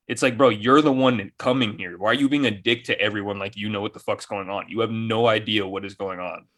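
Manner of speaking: ordinary delivery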